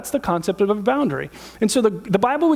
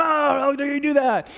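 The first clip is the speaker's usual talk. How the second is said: mock outrage; 6 semitones higher than usual. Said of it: "they're going".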